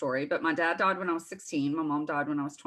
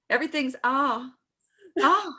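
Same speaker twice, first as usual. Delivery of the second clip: southern belle; 9 semitones higher, 3 dB louder than usual. Shown as a demonstration. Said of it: "You have to say".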